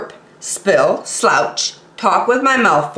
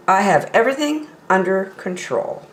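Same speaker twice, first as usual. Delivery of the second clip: usual speaking voice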